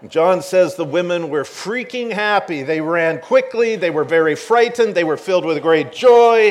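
rising excited retelling; excited build. Real, not acted. Describing the women's joy.

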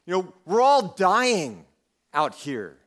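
upset. Acted. Panicked disciples rebuking sleeping Jesus in the storm, upset. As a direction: hit the key words hard, drawn out in disbelief. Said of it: "it seems like they're upset".

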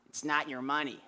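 lightly voiced odd mentor, flat and calm; a hint of an odd old mentor, calm; Gene's odd voice. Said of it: "not a total faithful picture of his voice".

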